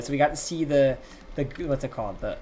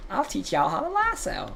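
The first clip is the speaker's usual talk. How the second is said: slow, higher Western drawl imitating the girl; Western accent; 6 semitones higher than usual. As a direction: put on a cowboy Western accent. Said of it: "it's a Western accent".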